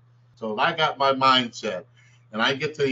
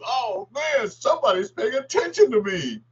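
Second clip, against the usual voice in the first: much higher, wondering delight; thrilled inner voice; 12 semitones higher than usual. Retold or imitated. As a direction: sound giddy that someone noticed you. His excited thought retold.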